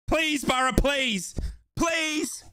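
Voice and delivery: ordinary talk, nothing marked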